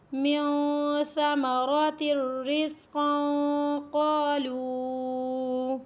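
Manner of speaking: slow melodic chanted recitation; Quran recitation. Acted. Teacher reciting the verse to her students, melodic chant. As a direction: chant the verse in measured tajweed.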